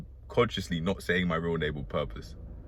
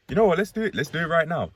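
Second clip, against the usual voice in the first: much higher, bursting with energy; sudden excitement; 10 semitones higher, 5 dB louder, faster than usual. Real, not acted. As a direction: jump into it, fired up. Fired up to start now.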